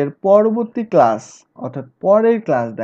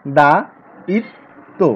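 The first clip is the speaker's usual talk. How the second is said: দা ইত তো split into separate beats; syllable by syllable; 3 dB louder than usual. Shown as a demonstration. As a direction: break দায়িত্ব into দা, ইত, তো with pauses. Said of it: "সেটা হচ্ছে".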